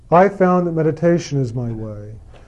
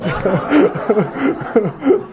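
laugh; 5 semitones higher than usual. Real, not acted. Laughing at own joke.

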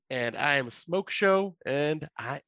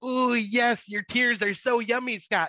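higher, gloating mock Cartman; Cartman impression; 8 semitones higher, slower than usual. Co-host imitates Cartman gloating over Scott's tears, higher gleeful voice. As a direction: savor it like a gleeful little villain. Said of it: "where Cartman is".

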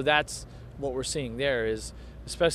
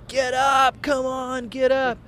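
high, raised imitation of screaming; retold scream; 10 semitones higher, 5 dB louder, slower than usual. Racer retelling a rival's friend urging him up, screaming. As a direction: call it out urgently, imitating his scream. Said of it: "screaming at me".

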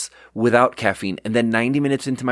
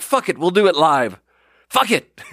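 higher, raised mock rant; mock angry scream; 6 semitones higher than usual. Imitating the angry news anchor from the clip, mock rant. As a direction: ham up the anchor losing it on air. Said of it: "he just starts screaming".

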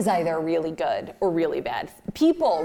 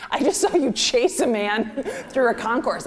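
higher, louder incredulous husband; appalled husband; 5 semitones higher, 4 dB louder than usual. Retold or imitated. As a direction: retell it as a scandalised spouse. Husband's appalled reaction, retold.

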